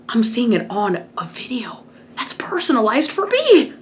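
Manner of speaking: breathy whisper rising to a squeak; awed whisper. Retold or imitated. Fan reliving seeing his license on video, awed whisper. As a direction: whisper in disbelief, pitch leaping at the end.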